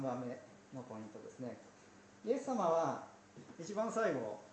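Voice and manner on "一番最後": ordinary talk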